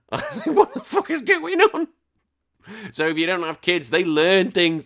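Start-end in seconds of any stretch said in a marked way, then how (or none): 0.0-1.9 high, squeaky laughing disbelief; helpless laughter. Retold or imitated. Telling the lift story, cracking up with high laughing. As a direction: squeeze it out through laughter.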